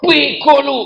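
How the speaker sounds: high, mock angry yell; teriak marah. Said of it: "deket kok teriak". (bentakan bernada tinggi)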